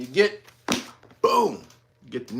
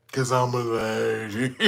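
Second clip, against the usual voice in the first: low, hushed mocking imitation; deep, quiet put-on voice; mock lady voice; 8 semitones lower, 6 dB quieter than usual. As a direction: drop it deep and soft, mock-ladylike. Mocks the award recipient again.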